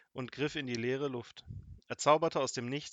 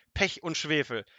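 loud, higher-pitched exclamation; angry curse; 4 semitones higher, 6 dB louder than usual. A narrator's reading. Wizard curses at Kasperl's mangled name, angry. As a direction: burst out cursing in exasperation.

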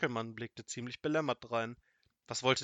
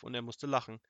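plain reading voice

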